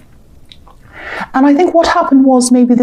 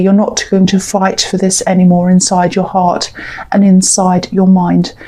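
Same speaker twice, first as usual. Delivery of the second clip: ordinary talk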